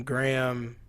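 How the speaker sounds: ordinary delivery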